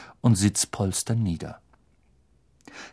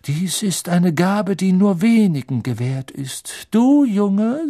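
high, drawn-out seer, rising to a sudden call; Professor Trelawney; 8 semitones higher, 5 dB louder, slower than usual. Acted. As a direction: proclaim solemnly, then pounce on a pupil. Trelawney singles out Neville. (gedehnt verkündende Wahrsagerin, dann plötzlich hoch rufend)

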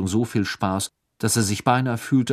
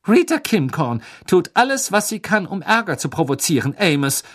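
indignant rebuke, higher and louder; upset; 8 semitones higher, 5 dB louder than usual. A narrator's reading. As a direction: scold him, clearly worked up. (aufgebracht, höher und lauter tadelnd)

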